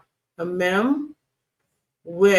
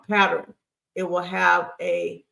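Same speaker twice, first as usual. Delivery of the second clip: ordinary delivery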